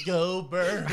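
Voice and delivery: put-on Philly accent, loud, Go Birds. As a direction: shout it in a hardcore Philly accent. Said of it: "With the hardcore Philly accent".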